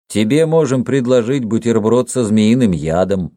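ordinary delivery

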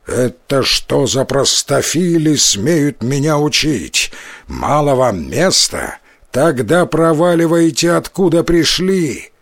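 ordinary delivery

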